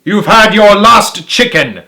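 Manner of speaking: gloating yell. A narrator's reading. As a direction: spit the threat out. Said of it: "yelled Boggis".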